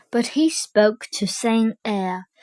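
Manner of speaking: ordinary delivery, nothing marked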